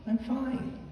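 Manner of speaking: high, breezy I'm fine; brushing it off. Retold or imitated. Parishioner brushes off the priest's question, high and breezy. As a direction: wave the question away.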